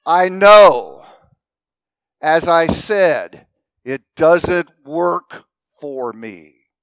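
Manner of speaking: a fed-up wife repeating herself, slow and firm; emphatic. Retold or imitated. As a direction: lean hard on each word. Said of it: "she says a little more emphatically".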